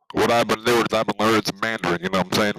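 ordinary talk

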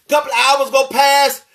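ordinary talk, nothing marked

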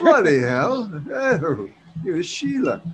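high, hammed-up British voice; bad British accent. Joking with friends in an overdone British accent. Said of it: "my British accent sucks".

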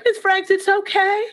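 put-on high child voice soothing the teacher; kids comforting. Students hugging their crying teacher, high childlike comfort. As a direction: play a little kid comforting her teacher. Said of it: "they're there".

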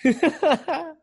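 a sudden high, loud laugh; real laugh breaking out; burst of laughter. Real, not acted. Reacts to partner's joke.

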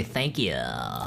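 low, quiet, lazy fried "thank ya"; a hint of bored vocal fry; mock vocal fry. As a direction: drop it down into a lazy creak. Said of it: "You know when they say".

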